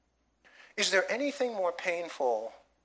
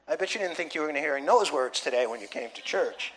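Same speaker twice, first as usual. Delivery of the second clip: normal talking voice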